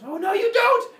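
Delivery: high, loud chasing yell; hammed-up cry of pursuit; mock outraged shout. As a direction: holler after someone getting away. Kronos chases fleeing father.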